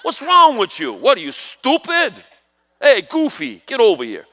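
hammed-up New York telling-off; drawn-out, punchy mock scolding; mock scolding elder. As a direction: imitate an exasperated New York parent. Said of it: "you'd hear it all the time".

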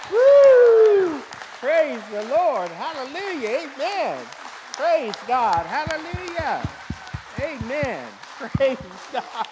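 loud, high joyful cries of praise; ecstatic shouting praise. Real, not acted. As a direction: shout your joy to the rafters. Celebrating the proposal.

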